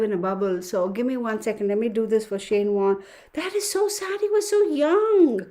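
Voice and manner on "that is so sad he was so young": sad. Real, not acted. Grieving over a death.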